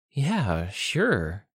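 usual speaking voice

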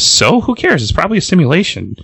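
high-pitched, acted brush-off; dismissive retort. Boom Boom answering Jubilee's warning, acted dismissiveness. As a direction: toss it off high, then hurry the rest.

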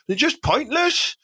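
ordinary delivery

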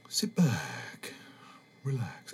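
put-on relaxation-tape voice, falling away; changed relaxation voice. Podcaster parodies a new age tape in a put-on soothing voice. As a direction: put on a hypnotist-style voice, letting each phrase sink. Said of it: "I'm changing my voice".

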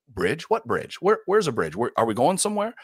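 put-on high, eager child's voice asking questions; curious little daughter. Five-year-old daughter asking dad about the bridge, high and curious.

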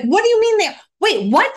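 shocked disbelief. Retold or imitated. Shocked reaction to church news.

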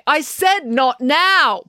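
loud, high, slow barked order. A narrator's reading. Mister Lang to the person pushing the door open, barking. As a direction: bark it, losing patience. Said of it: "barked Mister Lang".

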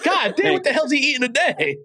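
high, incredulous laughing complaint; imitating his own reaction to the chewing, laughing; mock exasperation. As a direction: act amazed at what he's eating now. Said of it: "I'm like".

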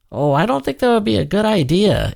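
high-pitched, hesitant objection; wary warning. A narrator's reading. Ansel warns Leo against being released, wary. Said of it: "Ansel warned".

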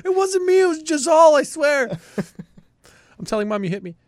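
put-on high, whiny kid blaming his brother; whiny tattling little sibling. Joking as Johnny's little brother.

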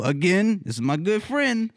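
ordinary delivery

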